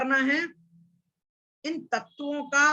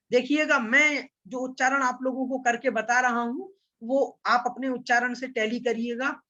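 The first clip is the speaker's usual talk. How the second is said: normal talking voice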